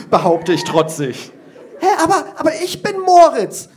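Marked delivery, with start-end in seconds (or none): 1.8-3.7 put-on high, laughing child's protest; a kid insisting, half giggling; insistent little girl. Girl pupil protests to the teacher, high and giggly.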